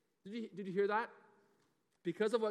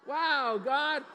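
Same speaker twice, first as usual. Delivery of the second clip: higher, louder, full shout of Wow God; shouted cheer; 6 semitones higher, 7 dB louder than usual. Pretend. Pastor rallies the kids with a shouted cheer. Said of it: "That's why we say".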